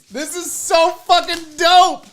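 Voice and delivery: high, slow, drawn-out excitement; thrilled amazement. Real, not acted. Unboxer showing friends' gift to viewers, thrilled.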